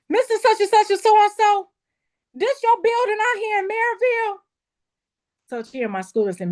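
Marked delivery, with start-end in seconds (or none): 0.1-4.4 excited. Retold or imitated. Retold excited call to the landlord.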